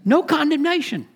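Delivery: NO pitched high and stressed; "No condemnation" with a high, ringing No; emphatic quote. Retold or imitated. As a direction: lift "No" up high as God's verdict. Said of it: "But He says".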